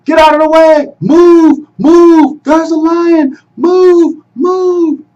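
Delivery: high, loud desperate scream; screaming warning. A narrator's reading. Musa running to warn family of the lion, screaming. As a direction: scream at them to move. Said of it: "screaming out".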